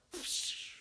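quick breathy whoosh; imitated arrow release. Man drawing his bow at random, arrow whoosh. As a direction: make the sound of an arrow flying off. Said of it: "and just went".

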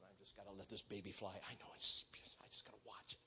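very soft, low muttering into a whisper; secretive whisper. Acted. Lone archer tempted to let his arrow fly, whispering. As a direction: whisper it to yourself, sneakily.

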